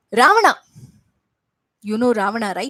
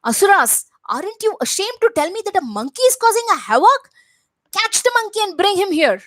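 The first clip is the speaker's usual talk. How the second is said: scornful demon king; 10 semitones higher, 3 dB louder than usual. Acted. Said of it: "And Ravana said".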